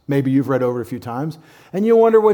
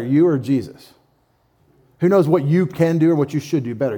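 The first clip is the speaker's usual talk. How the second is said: usual speaking voice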